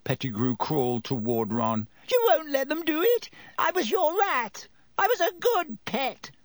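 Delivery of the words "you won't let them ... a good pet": high squeaky begging; frantic pleading. A narrator's reading. Pettigrew crawls toward Ron, begging.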